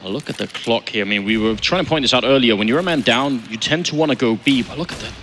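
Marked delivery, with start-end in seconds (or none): none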